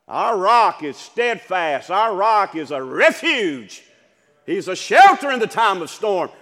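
loud, driving preacher cadence; full-force preaching. Acted. Preacher to his congregation, loud and driving. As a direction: hammer each line home to the congregation.

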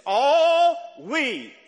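"All" drawn out loud and high; "All" held and hit with force; stress on All. Shown as a demonstration. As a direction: stretch "All" long and lean hard on it. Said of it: "underline that word all".

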